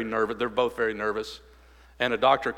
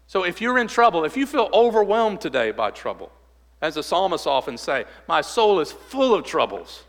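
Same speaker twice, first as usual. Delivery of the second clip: louder, higher pleading preacher's appeal; fervent preaching; 4 semitones higher, 4 dB louder than usual. Acted. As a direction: press the question on the congregation. Preaching at full force.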